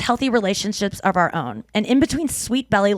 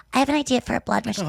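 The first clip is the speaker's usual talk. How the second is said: pitch voice; 3 semitones higher, faster than usual. Retold or imitated. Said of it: "if I was pitching like this".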